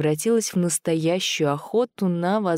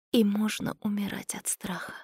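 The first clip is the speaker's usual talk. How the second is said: frightened inner thought; 4 semitones higher, 6 dB quieter than usual. A narrator's reading. Girl thinking as creatures close in, frightened whisper. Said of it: "подумала Света".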